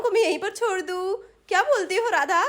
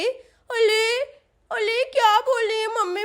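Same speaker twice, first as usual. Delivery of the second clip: put-on higher-pitched, babyish child's complaint; protesting little girl; 5 semitones higher than usual. Sonam refuses mummy's order to stay home, whiny protesting child. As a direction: whine like a small child refused an outing.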